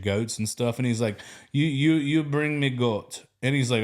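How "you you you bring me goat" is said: put-on slow, broken-English Ghanaian accent; a light Ghanaian accent for the coworker. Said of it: "it mixes in with his accent".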